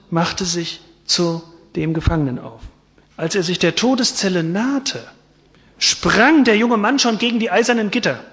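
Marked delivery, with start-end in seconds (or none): none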